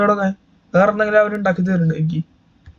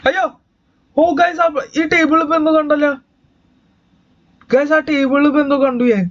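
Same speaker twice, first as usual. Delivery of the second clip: scared; 5 semitones higher, faster than usual. Real, not acted. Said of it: "എനിക്ക് പേടിയാവണം ... ഞാൻ വിറയ്ക്കാണ്".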